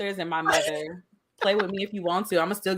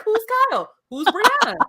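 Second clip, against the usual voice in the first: confused boss; 13 semitones higher than usual. Retold or imitated. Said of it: "He was like".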